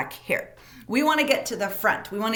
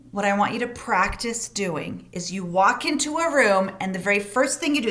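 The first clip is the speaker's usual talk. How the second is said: ordinary delivery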